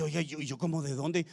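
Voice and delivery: mock private bafflement, voice dropped; puzzled aside. Listener asking himself where it came from, quietly puzzled. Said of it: "Que usted dice".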